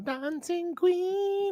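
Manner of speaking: put-on high, slow, sing-song Welsh accent; drawn-out Welsh accent impression. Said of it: "That's her Welsh accent".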